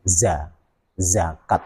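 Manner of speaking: "zakat" said slowly with a buzzing z, not j; slow model "Za, zakat" with a clear z; correct zakat. Shown as a demonstration. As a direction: zakat with Arabic za. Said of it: "kita tempelkan ke tempat pertama".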